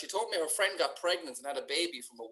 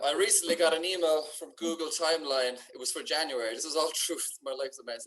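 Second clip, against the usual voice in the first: ordinary talk, nothing marked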